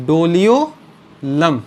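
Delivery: Doliolum drawn out in separated parts; spell-it-out pacing on Doliolum; slow dictation. Real, not acted. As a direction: say Doliolum slowly, breaking it before the last syllable. Repeats new term for note-taking.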